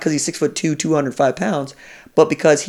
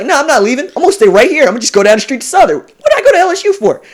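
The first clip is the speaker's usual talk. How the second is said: much higher and louder, insisting he's staying; rising high and loud, faster in the middle; defiant young player; 11 semitones higher, 7 dB louder, faster than usual. Retold or imitated. Voicing Marcus Spears refusing Saban.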